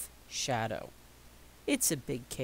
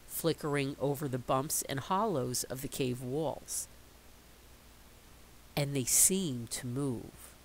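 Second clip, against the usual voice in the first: usual speaking voice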